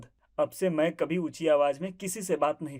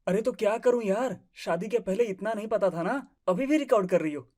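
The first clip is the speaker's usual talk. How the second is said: usual speaking voice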